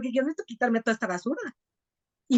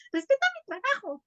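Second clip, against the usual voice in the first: high, pointed demand for respect; demanding wife; 10 semitones higher than usual. Pretend. Pretend wife demanding respect from her husband, demanding. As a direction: insist on it, pitched well up. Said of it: "Yo no tenía que decirle a mi marido".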